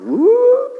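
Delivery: sudden shrill "woo"; mock glory whoop; high whooping cry. Transfiguration glory imitated.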